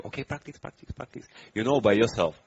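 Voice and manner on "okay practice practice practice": high, hushed voice fading to a whisper; imitated prodding teacher. A bhante telling students to practice, imitated in a hushed voice. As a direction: whisper a mock nag, barely voiced. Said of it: "some bhante who tell you".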